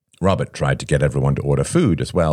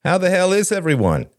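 high, hearty group greeting; expansively sociable. A narrator's reading. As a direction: open it up to the whole table, high-pitched. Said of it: "said Robert expansively".

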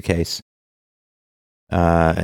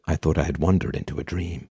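dreamy epic line; 8 dB quieter than usual. Acted. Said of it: "should be replaced with".

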